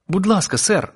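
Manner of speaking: high, urgent call for attention; impatient plea. A narrator's reading. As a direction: pipe up impatiently as a young boy. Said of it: "почув нетерплячий голос".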